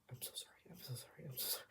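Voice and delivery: repeated frantic whisper; whispered apology. Retold or imitated. Singer apologising to director, re-enacted whisper. Retelling panicked apology in rehearsal.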